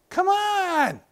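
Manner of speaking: slow, drawn-out high come on; dragged high impatient groan of words. Retold or imitated. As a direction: stretch it out, fed up. Fidgeting impatiently in line.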